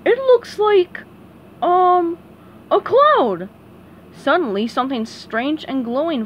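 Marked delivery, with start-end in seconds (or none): 0.0-3.5 high, loud dopey starfish; slow-witted and pleased with himself; Patrick voice. Acted.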